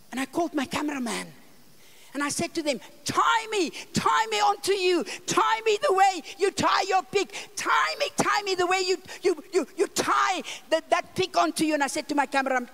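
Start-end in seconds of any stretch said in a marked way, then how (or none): 3.1-11.7 urgent shouted command. Retold or imitated. Mother ordering the tribesmen to carry her, shouting urgently. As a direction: call it out boldly.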